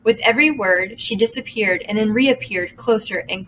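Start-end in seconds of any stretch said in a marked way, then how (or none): none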